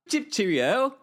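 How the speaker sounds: high, playful mock-British sign-off; put-on English accent. Signing off the weather chat, in a mock English accent. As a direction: chirp it like a pretend Brit. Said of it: "speak like this for the rest of the live".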